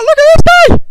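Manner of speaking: high, loud squeals of disbelief at the screen; squealing gamer watching carnage; excited shriek. Real, not acted. Shrieking at battle replay.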